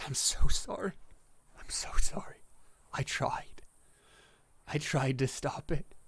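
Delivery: tearful, breaking apology in character; acted crying, voice catching into whispers; acted sobbing. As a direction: play a god weeping with regret. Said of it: "openly sobbing now".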